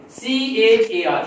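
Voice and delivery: सी एच ए आर spelled slowly letter by letter; slow deliberate letter spelling. Shown as a demonstration. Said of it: "शेर आप बताएं".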